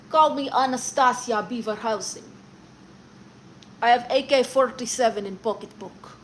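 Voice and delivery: loud, hammed-up Russian accent. Streamer performing for a viewer on request, mock Russian accent. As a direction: play a mock Russian character, projecting loudly. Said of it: "Can you do a Russian accent?".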